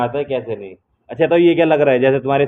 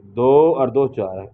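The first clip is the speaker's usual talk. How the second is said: 1 slow demo; 2 semitones lower, much slower than usual. Shown as a demonstration. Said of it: "teacher demonstrates the sum".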